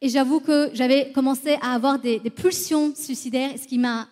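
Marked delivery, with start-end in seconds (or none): none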